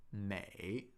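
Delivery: 美 soft and low, dipping third tone. Shown as a demonstration. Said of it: "美 has a third tone".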